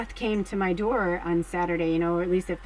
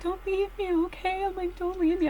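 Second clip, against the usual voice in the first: retold crying plea; 10 semitones higher than usual. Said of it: "I was, like, crying".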